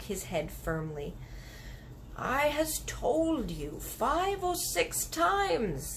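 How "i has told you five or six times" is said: slow, higher big friendly giant, patient; a gentle giant explaining himself slowly. Acted. Giant explaining to the girl, slow and patient. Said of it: "the B F G said".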